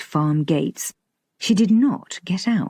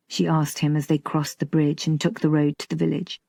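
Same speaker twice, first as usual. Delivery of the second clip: plain reading voice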